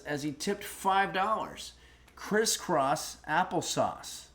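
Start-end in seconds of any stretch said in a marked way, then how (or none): 2.2-4.2 crisscross applesauce said very slowly, savoring each word. Shown as a demonstration. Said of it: "I think that might be a saying".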